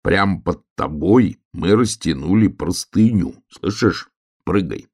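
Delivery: ordinary delivery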